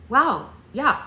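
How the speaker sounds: can't believe her luck; thrilled. Acted. Thrilled at unexpected offer.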